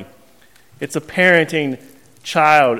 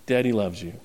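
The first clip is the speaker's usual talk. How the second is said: soft, lower, tender; 8 semitones lower, 9 dB quieter than usual. Retold or imitated. Father greeting his newborn son, soft and tender. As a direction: hush it to a newborn.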